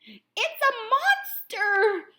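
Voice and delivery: slow, high, loud fearful moan; moaning Mama Bear. A narrator's reading. Mama Bear seeing Goldilocks, slow fearful moan. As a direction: moan it in alarm. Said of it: "moaned Mama Bear".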